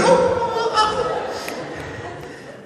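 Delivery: panicked granny caught out, mock fluster. Grandmother caught watching when the grandchild walks in, high flustered gasps. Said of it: "she was always like".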